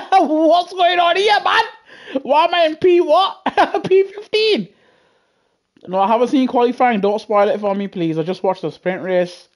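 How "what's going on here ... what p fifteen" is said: can't believe where he's starting; shocked disbelief. Real, not acted. Streamer stunned by his race position, squealing high in disbelief.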